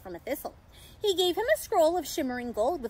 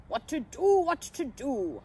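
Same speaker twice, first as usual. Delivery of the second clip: Santa. A narrator's reading. Santa worried for the small pixie, fretting. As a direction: fret aloud in a character voice. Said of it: "I heard Santa say".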